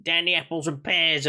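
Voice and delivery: mock Cockney rhyming slang; London Cockney accent. Shown as a demonstration. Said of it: "like a London Cockney lad".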